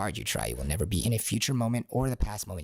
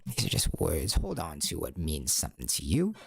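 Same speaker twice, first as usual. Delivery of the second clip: normal talking voice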